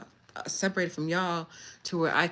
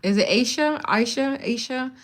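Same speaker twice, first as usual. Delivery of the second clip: higher, eager calling of a name; 7 semitones higher, 5 dB louder than usual. Real, not acted. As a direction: greet her like spotting a friend.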